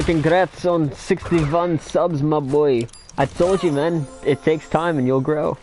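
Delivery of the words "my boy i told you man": ordinary delivery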